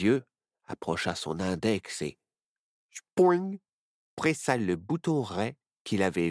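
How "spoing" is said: spoing. A narrator's reading. Sound of button pressed.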